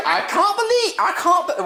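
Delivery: put-on high, loud Australian accent; Lockie in Australian accent. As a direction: squawk it like an outraged Aussie mate. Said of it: "it was a Australian accent".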